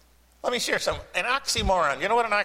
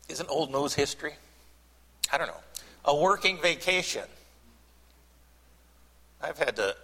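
usual speaking voice

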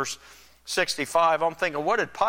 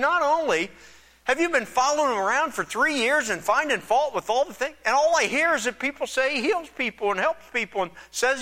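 higher, exasperated Pilate voice; higher, quicker exasperated governor; 8 semitones higher than usual. Retold or imitated. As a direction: play Pilate fed up with the priests. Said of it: "He's saying".

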